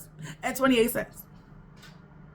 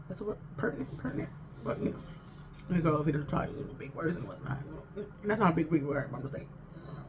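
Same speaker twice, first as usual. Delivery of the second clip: muttered aside; 6 semitones lower, 11 dB quieter, faster than usual. Real, not acted. Muttered aside about words.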